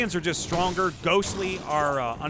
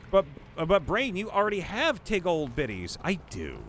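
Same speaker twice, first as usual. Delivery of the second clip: ordinary talk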